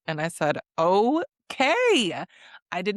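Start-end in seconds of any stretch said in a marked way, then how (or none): 0.8-2.2 drawn-out, high-pitched okay; playful retold astonishment; impressed surprise. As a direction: react with a swooping, impressed okay. Impressed reaction to Kata's threat.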